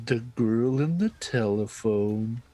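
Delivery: slow, stretched top-ten narrator impression; Chills. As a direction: drag it out in an odd narrator accent. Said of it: "That's a Chills accent right there".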